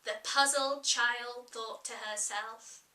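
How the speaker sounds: plain reading voice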